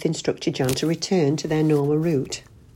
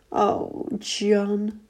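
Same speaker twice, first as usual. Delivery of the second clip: wife moans after the crash; 6 semitones higher than usual. Acted. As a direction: breathe his name out weakly. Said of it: "Ruth opened her eyes and moaned".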